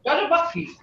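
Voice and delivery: high-pitched, emphatic mad demo. Shown as a demonstration. Teacher showing you're telling people, not just saying it, high mad tone. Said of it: "you're telling people".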